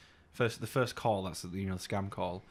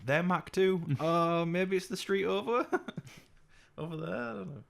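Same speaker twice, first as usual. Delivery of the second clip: mock scammer; 10 semitones higher, 3 dB louder than usual. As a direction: ham up a baffled caller. Mock scammer reply, teasing.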